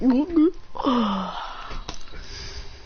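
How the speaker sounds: long exhale after a gulp; gulp, then let out a long "ah"; satisfied drinking sigh. Retold or imitated. Boy drinking his orange juice, long satisfied sigh.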